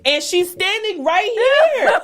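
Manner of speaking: high, loud, drawn-out protest; loud indignation. Real, not acted. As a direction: complain loudly, stretching the words. Indignant about clerk standing too close.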